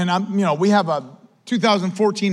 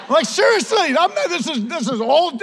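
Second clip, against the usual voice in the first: laughing insistence; 8 semitones higher than usual. Real, not acted. Insisting story is true.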